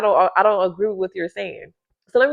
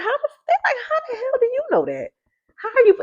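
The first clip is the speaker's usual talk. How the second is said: high squealing disbelief, far above her own voice; baffled mock squeal. Mocking fake people who are stunned at being seen through, high squeal. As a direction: squeak out their shock like a caught faker.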